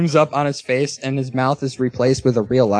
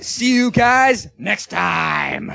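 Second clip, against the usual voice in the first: announcer voice; 3 semitones higher, much slower than usual. Acted. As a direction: boom it like a radio host. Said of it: "That was actually better".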